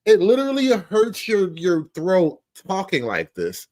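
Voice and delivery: ordinary talk, nothing marked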